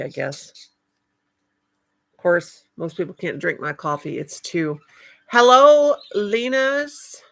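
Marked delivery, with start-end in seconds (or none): 5.3-7.2 greeting shouted out loud; loud hello. Real, not acted. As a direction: call out hello to a newcomer. Greeting a viewer loudly.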